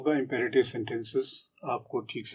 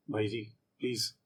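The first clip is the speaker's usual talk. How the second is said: much softer plea; pleading request; 4 semitones lower, 12 dB quieter than usual. Shown as a demonstration. Asking nicely as a request, soft plea. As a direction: beg quietly. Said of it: "अनुरोध कर रहे हैं".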